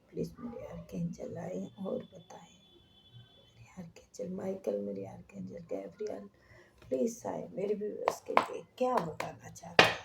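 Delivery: fast, breathy pleading whisper to the spirits; whispered invocation. Acted. Reader calling archangels for her viewers in rushed whisper. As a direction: plead under your breath, hurried and intimate. Invoking archangels before reading.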